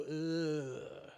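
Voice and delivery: long drawn-out mock "ugh"; kids' disgusted groan. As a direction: groan like grossed-out kids. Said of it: "even if the kids go".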